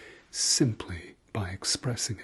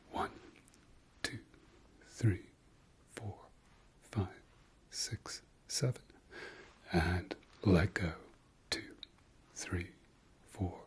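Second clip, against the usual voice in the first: measured count with long gaps between numbers; slow breathing count; 5 dB quieter than usual. Shown as a demonstration. Guide leading listeners through seven eleven breathing, slow soft count. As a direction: count softly and unhurriedly, leaving space to breathe. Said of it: "let's go a bit quicker".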